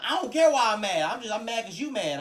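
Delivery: loud, high, indignant loyalty; hyped-up support. Acted. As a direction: act out a friend mad purely on your behalf. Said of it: "I like that energy".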